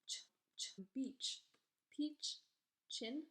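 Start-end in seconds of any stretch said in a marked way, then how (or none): 0.1-0.8 "ch" as a bare voiceless burst; isolated, repeated "ch"; letter sound demo. Shown as a demonstration. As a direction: say "ch" as in beach, without a vowel. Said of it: "C H".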